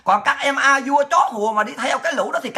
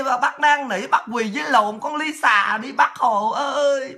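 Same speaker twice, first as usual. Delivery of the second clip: mock wailing lament; 4 semitones higher than usual. Mock-crying to Uncle Ho that Lisa insults him, hammed-up wail. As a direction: mourn Uncle Ho like a fake grieving child, laughing.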